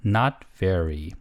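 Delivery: "Not very" drawn out, very slow and low; the teacher repeats "Not very" slowly for the class. Shown as a demonstration. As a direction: stretch "Not very" so students can follow. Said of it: "한 번 더".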